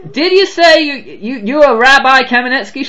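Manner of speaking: put-on half-hearted southern accent, stammering in surprise. Hotel operator asking the caller, amazed southern accent.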